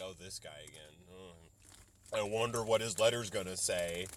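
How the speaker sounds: a mock weary sigh at this guy again; lightly voiced bored office worker; weary admissions officer. Imitating the admissions person opening yet another letter from him, tired and sarcastic. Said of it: "the admissions person at the office is like".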